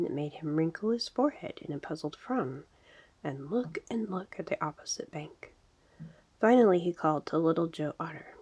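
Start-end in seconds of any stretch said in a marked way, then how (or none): none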